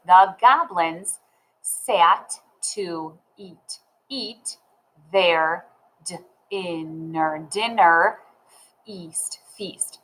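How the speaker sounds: very slow, word by word with gaps; sounding-out reading. Shown as a demonstration. Teacher modelling how to read the story, slow decoding. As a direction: read like decoding, breaking "dinner" and "feast" into sounds, then blending. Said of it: "So let's read".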